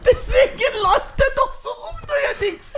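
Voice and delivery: high, slow, shrieking with laughter; laughing. Real, not acted. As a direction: laugh it out, almost crying. Cracking up while telling joke.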